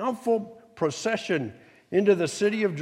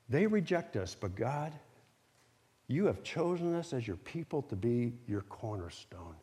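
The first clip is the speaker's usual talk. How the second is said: softer, dropping low by the end; humble prayer; 5 semitones lower, 7 dB quieter than usual. Acted. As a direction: confide it quietly to God. Voices Israel's humble prayer to God.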